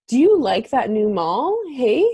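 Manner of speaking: "Hey?" tacked on high and loud, ending the question; lightly hammed Canadian question with "Hey?" on top. Shown as a demonstration. Demonstrating the Canadian hey to her co-host, loud and high. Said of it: "it's that we're saying this".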